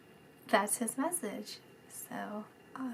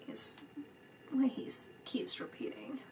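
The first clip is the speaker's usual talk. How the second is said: whisper; 3 semitones higher, 12 dB quieter than usual. Real, not acted. Whispered aside in reading.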